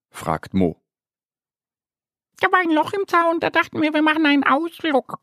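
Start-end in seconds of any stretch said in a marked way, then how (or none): 2.4-5.2 very high, fast hen voice; quick high clucking chatter. Acted. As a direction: cackle the words like an excited chicken. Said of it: "gackert ein Huhn".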